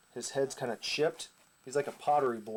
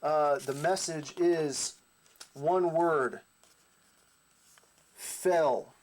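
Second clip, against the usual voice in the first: dramatic reveal; 3 semitones higher, much slower than usual. Retold or imitated.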